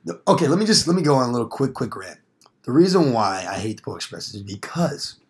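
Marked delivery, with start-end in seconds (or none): none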